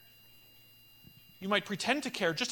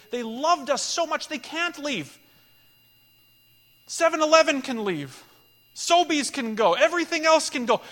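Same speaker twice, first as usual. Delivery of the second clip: much louder and higher, each name hammered; preaching at full force; 6 semitones higher, 6 dB louder than usual. Real, not acted. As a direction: rise to a preaching shout for the church. Preacher builds to climax.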